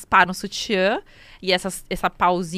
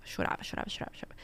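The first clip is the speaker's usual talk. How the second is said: sussurro quase sem som; sussurrado; 13 dB quieter, faster than usual. Real, not acted. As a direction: deixa a voz sumir num sussurro. Relata choro sem parar. (repetição sussurrada, bem baixinho)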